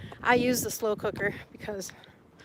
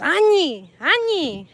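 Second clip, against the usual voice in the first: louder, higher añi; emphatic Yaqui reply; 4 semitones higher, 8 dB louder, slower than usual. Shown as a demonstration. Demonstrating the Yaqui answer to an angry person, emphatic. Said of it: "the answer is".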